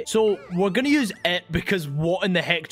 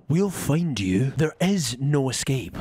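menacing villain; 7 semitones lower, slower than usual. Acted. Villain threat to crab.

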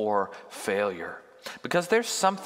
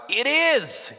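angry retort; 13 semitones higher, 6 dB louder than usual. Acted. Jonah answers God in anger.

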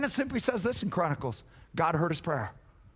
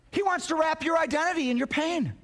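ordinary talk

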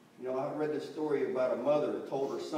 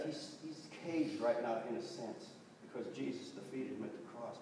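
ordinary delivery

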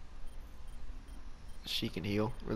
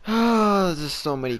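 sudden loud, high "Oh" of annoyance; frustrated cry; 9 semitones higher, 9 dB louder than usual. Real, not acted. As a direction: blurt it out in frustration. Killed again, frustrated cry.

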